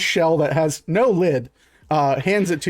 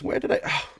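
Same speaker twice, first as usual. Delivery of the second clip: shopkeeper muttering; 5 semitones higher, 4 dB quieter than usual. Acted. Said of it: "looks over and goes".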